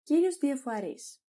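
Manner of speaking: ordinary talk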